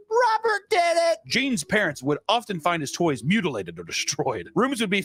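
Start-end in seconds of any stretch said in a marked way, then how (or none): 0.1-1.2 put-on very high, slow sing-song child voice; whiny kid blaming. Boy blaming the doll for mishaps, very high whine.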